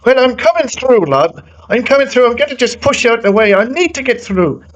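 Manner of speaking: high-pitched mock racer voice, pushing through; imitating Alex Dunn. Pushy driver telling others to clear out, high mock impression. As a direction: put on a high, insistent impatient driver voice. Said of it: "Alex Dunn is like".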